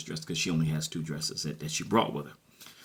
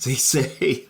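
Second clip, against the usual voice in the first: laughing; 7 semitones higher, 8 dB louder than usual. Real, not acted. Laughs over the quoted phrase.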